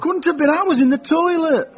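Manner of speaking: high-pitched protest; affronted protest; indignant denial. Acted. Protesting the accusation.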